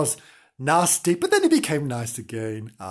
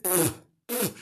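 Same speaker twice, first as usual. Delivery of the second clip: sputtering, spitting out soap; spitting noise; 3 semitones higher, 5 dB quieter than usual. Acted. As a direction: blow out disgusted little raspberries.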